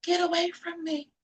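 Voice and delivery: small, high, soft plea; mock a timid telling-off, high and hushed; meek little high-pitched voice; quiet little protest. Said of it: "it's not a quiet little".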